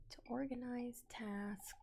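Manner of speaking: slow, soft whisper to self. Real, not acted. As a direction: murmur it under your breath.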